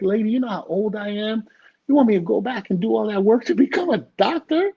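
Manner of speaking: reenacting a flabbergasted son; retold incredulity. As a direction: push back in exaggerated disbelief. Repeats incredulous objection to mother.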